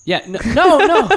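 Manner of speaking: high, loud playful no; mock disbelief. Real, not acted. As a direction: protest with a laugh.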